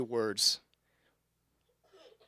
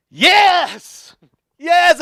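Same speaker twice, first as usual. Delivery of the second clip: much higher and louder, exultant; triumphant yes; 11 dB louder than usual. Retold or imitated. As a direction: gloat over the tailgater getting caught. Cop pulls over tailgater, triumph.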